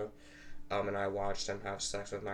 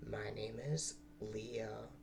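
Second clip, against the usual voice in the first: soft, slow, much quieter; 10 dB quieter, much slower than usual. Pretend.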